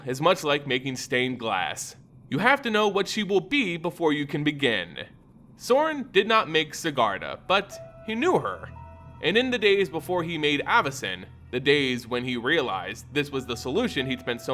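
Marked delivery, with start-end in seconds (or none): none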